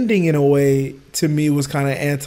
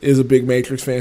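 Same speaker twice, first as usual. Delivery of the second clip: ordinary talk, nothing marked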